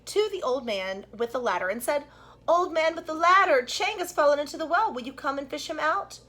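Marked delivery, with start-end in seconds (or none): 2.5-6.2 put-on high, loud child's call for help; a child shouting for help at a well. A narrator's reading. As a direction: call urgently to the old man. Chang calls to old man.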